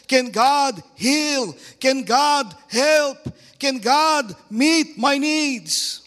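high, loud, drawn-out rhetorical questions; forceful preaching. Real, not acted. Preacher voices Christians doubting God's power, full-force preaching. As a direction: hammer each question out slowly and loudly.

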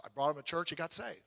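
normal talking voice

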